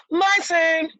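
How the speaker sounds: high, loud demanding shout; eager cry. A narrator's reading. Youngest stepsister shoving in for the slipper, high and loud. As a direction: claim your turn loudly. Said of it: "cried the youngest stepsister".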